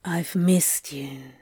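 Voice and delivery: slow, low seductive murmur; Crowley, low and seductive. A narrator's reading. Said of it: "his voice low and seductive".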